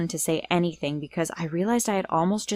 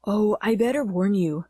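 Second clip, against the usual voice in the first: fake guy voice. A narrator's reading. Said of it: "in that guy voice of hers".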